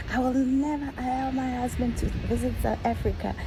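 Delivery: high, teasing repeat of the warning; mock jealous joke. Wife teasing the visitor about her husband, high and mocking. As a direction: sing it out like a laughing threat. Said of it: "she said it, like, in a joke, funny".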